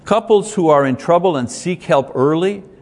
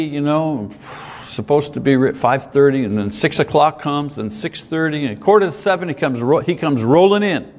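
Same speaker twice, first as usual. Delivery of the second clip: ordinary talk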